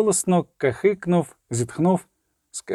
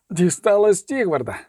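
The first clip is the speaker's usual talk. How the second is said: higher, strained exhalation; gasped out; 4 semitones higher than usual. A narrator's reading. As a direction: push the news out on a heavy breath. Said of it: "видихнув він, важко підіймаючись".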